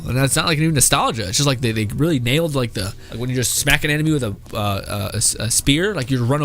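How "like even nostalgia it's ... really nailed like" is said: normal talking voice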